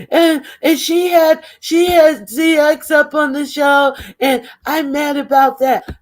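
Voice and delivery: high, put-on aggrieved voice; mock whining complaint. Woman mimicked explaining why she is mad, mock aggrieved.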